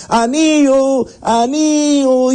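high, drawn-out vendor's cry; street vendor calling out. Acted. Young man shouting to sell a ring at the market, calling out high. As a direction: hawk the ring to passers-by at the market gate. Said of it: "está gritando y nadie le hace caso".

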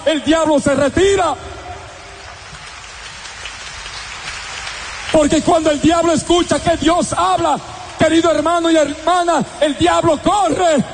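ringing, insistent sermon voice; fervent preaching. Acted. Preacher telling the faithful the devil flees, fervent. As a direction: hammer the promise home.

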